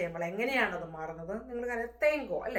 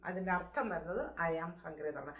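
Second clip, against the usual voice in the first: usual speaking voice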